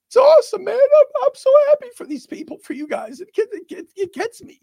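fighting back tears, pitch jumping up; choking up. Real, not acted. Host telling Lions fans he's happy, voice cracking. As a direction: voice cracking high with emotion. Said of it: "I'm literally almost choking up".

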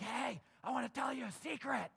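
mock stage whisper, loud and high; super loud whisper. Someone sharing a secret far too loudly, loud whispering. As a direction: whisper so the whole room hears. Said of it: "who whisper super loud".

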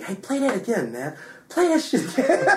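high, climbing plea for a replay; imitated excited request. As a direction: mimic a listener hooked on the song. Retelling excited request to replay song.

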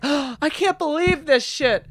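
high, slow, mock-amazed after a gasp; mock gullible viewer. Mocking viewers who believe fake videos, high mock awe. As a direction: gape like a sucker who buys it.

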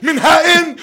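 high-pitched imitation of a screamed punchline; hammed-up high outburst; mock scream. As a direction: mimic her yelling the joke. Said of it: "She starts screaming her, her jokes".

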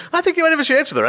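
high-pitched sarcastic quote. Retold or imitated. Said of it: "I'm not being sarcastic".

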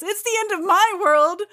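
put-on high, loud wailing teen voice; melodramatic teen Kitty. Kitty answering Storm, high overwrought teen wail. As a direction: wail it like a sulking teenager. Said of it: "And Kitty says".

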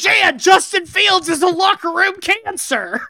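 high, loud Bears fan rant. Acted. A Bears fan rants to Jan about Justin Fields, loud. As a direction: gripe at Jan like a barstool fan. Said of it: "doing a Bears fan".

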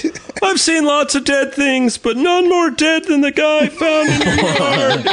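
very high, slow imitated holler; screaming deaf neighbor. Host as deaf neighbor about the body found, high imitated scream. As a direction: stretch it out in the deaf man's screamed voice. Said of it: "he just screams from all that tractor work".

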